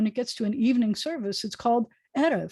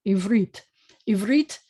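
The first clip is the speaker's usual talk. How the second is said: "ivrit" with the accent on its final syllable; stress on last syllable; 4 dB louder than usual. Shown as a demonstration. Teacher modelling the word for Hebrew, last-syllable stress. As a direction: lean on the "rit" of "ivrit". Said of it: "the accent's on the last syllable".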